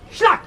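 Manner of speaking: loud, high snap sound; shouted sound of snapping elastic. Retold or imitated. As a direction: mouth the snap of a released rubber band.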